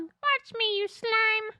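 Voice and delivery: high, sneering villain voice; alien hunter. Acted. Hunter defies Ella, high villain voice. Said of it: "Alien hunter number one".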